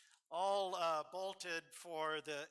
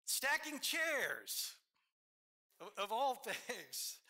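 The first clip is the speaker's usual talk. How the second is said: incredulous; 7 semitones higher, slower than usual. Real, not acted. Amazed at Bill's work.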